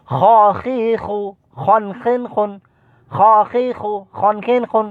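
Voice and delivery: "খ খি খু" chanted high with throaty kh; high, level recitation of "খ খি খু"; kha pronunciation drill. Shown as a demonstration. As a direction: model the kh of "খন খিন খুন" for pupils. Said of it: "খর মস্কটা আমরা করতেছি".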